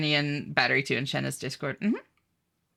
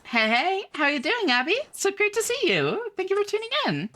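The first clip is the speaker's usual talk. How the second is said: bright high-pitched streamer greeting, much higher than usual; 14 semitones higher than usual. Acted. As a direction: chirp a cheerful hello to chat in a high voice.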